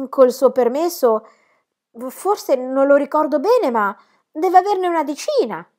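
smooth things over eagerly; pleading aunt. Acted.

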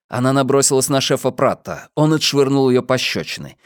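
ordinary delivery

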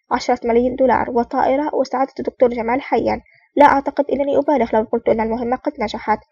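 ordinary delivery, nothing marked

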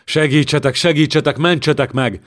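cornered king howling for help; howling for rescue. Acted. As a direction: wail like a trapped creature. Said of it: "Zsigmond úgy üvölt".

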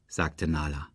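ordinary delivery, nothing marked

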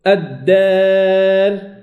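الدار drawn very slowly, alif leaning toward e; imala in recitation. Shown as a demonstration. Teacher recites examples of imala. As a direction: stretch الدار long with alif bent to e. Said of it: "كذلك".

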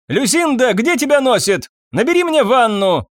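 sharp high call to a servant. A narrator's reading. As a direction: summon the maid impatiently. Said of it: "крикнула она в коридор".

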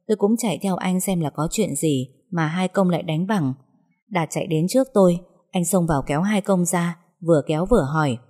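plain reading voice

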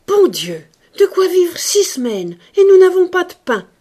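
high, loud outraged outburst; outraged cry. A narrator's reading. Old maid crying out at the costly gift, outraged. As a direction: burst out at the wasted money. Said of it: "ne put retenir ce cri".

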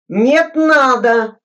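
loud, high, slow and sharp; sharp retort. Acted. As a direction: cut him off hard. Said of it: "резко сказал Глебов".

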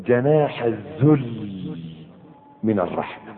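slow, low recitation fading out. Shown as a demonstration. Preacher reciting the verse slowly to the listeners, fading. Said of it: "ها؟".

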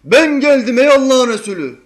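loud high call across the room; shouting. Retold or imitated. Rude visitor shouting at the Prophet, loud high call. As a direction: bellow the greeting with no manners. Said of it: "ona bağıranlar".

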